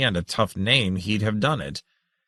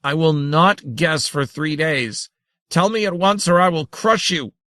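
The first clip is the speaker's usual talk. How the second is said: quivering threat; 7 semitones higher, 3 dB louder than usual. A narrator's reading. Saren threatens to crush the grandmother, high and quivering. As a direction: threaten her though you are scared. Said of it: "Saren threatened in a quivering voice".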